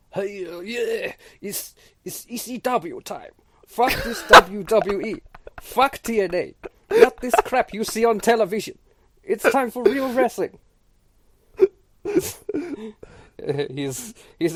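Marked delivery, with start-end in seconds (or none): 0.1-10.6 loud, high hyped-up wrestling promo shout; wrestler promo voice. Retold or imitated. Uncle imitating a drunk ECW wrestler, hammed-up promo yell. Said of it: "and he's like". 12.0-13.7 high burst of laughter; big laugh. Real, not acted. Cohost laughing hard after his wrestler impression, big laugh.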